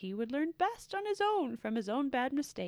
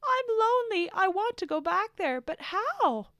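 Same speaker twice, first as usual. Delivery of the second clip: much higher and louder, pleading cry; desperate shout; 12 semitones higher, 8 dB louder than usual. A narrator's reading. Blue shouts after the leaving purple Spitian, pleading. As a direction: cry out, begging to go back. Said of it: "But Blue shouted".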